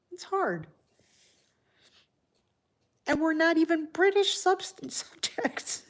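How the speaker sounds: fighting tears, laughing through them; voice breaking. Real, not acted. Grieving host, voice cracks.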